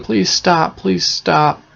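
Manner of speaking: high, repeated mock-tearful begging; crying plea. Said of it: "Gretel just kept crying".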